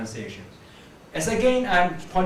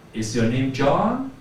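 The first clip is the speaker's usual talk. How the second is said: slow question lifting on JOHN; rising intonation; 3 semitones lower, 3 dB louder, slower than usual. Shown as a demonstration. Teacher getting up as she asks, rising intonation. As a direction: let "John" climb at the end. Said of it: "to lift up my voice".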